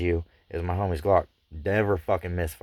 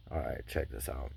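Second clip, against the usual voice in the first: quiet resigned muttering; 5 semitones lower, 11 dB quieter than usual. Retold or imitated. Bracing himself after bad news.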